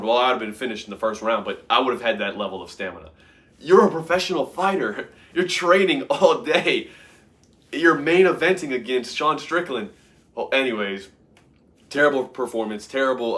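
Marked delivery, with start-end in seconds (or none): 3.6-9.9 high, louder scolding; exasperated rant. Real, not acted. Scolding the fighter with no stamina, exasperated. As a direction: berate the tired fighter.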